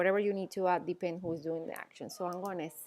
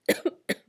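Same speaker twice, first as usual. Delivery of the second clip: loud short cough; real throat-clearing cough; 8 dB louder than usual. Real, not acted. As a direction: cough once more, hard. Coughing fit interrupts the lesson.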